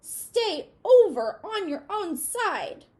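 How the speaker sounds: a cockroach shouting at its neighbour; angry yell. A narrator's reading. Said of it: "an angry voice yelled".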